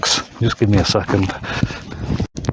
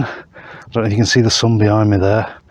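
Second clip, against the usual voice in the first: normal talking voice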